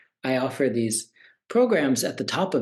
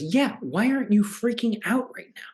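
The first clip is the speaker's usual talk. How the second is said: business partner voice; 10 semitones higher than usual. Retold or imitated. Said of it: "And his business partner goes".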